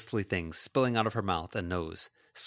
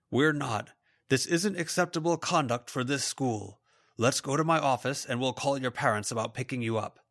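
higher, lightly voiced firm authority; reprimanding teacher; 5 semitones higher than usual. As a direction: lay down the school's rules. Raymond rebukes the students.

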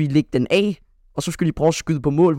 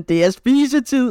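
playful coach call; 8 semitones higher, slower than usual. Retold or imitated. Coach Kim announces dinner time.

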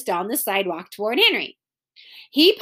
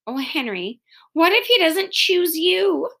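higher, anxious question; mildly acted worry, pitched up; fearful; 6 semitones higher than usual. A narrator's reading. Beezus fears the dog won't choose Henry, fearful. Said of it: "asked Beezus fearfully".

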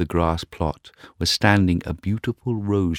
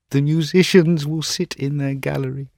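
put-on young student voice; 10 semitones higher, 5 dB louder than usual. Student dreaming.

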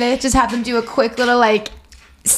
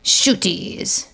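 mock French accent. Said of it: "Why does your voice go so low?".

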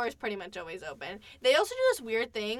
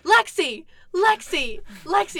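loud, higher repeated calls of the name; calling out; 6 semitones higher, 7 dB louder, slower than usual. Retold or imitated. Friend calling her name at her door, loud. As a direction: holler the name again and again. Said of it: "and I hear".

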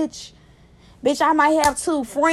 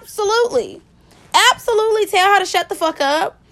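emphatic approval; 6 semitones higher, faster than usual. Retold or imitated. Viewer siding with Kayla against the nurse, emphatic. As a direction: back her up, pitched up and emphatic. Emphatic reaction, cheering on the patient.

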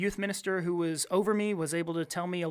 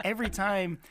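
normal talking voice

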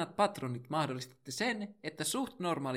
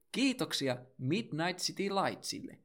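usual speaking voice

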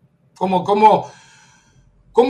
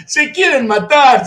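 riendo; 6 semitones higher than usual. Real, not acted. Se ríe del rival.

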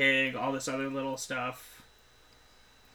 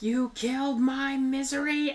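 mock Annie; 11 semitones higher than usual. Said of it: "she's like".

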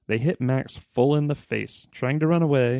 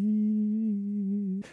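high, soft pleading; begging; 9 semitones higher, 4 dB quieter than usual. A narrator's reading. Max begging his dad to stop, softly. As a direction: whimper "Please" softly and high. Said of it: "Max begged".

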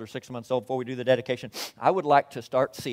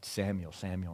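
hushed voice of God; 5 semitones lower, 9 dB quieter than usual. Acted. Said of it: "He said".

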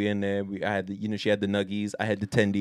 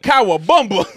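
mock yell; 7 semitones higher, 5 dB louder than usual. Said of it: "I just yelled out".

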